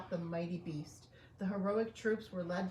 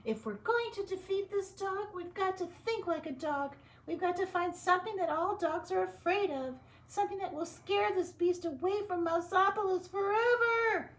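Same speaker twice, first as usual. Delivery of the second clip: very high squeaky scientist; professor voice; 12 semitones higher, 5 dB louder than usual. Acted. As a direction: plan it out like a prim mouse professor. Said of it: "I gotta think about my voice".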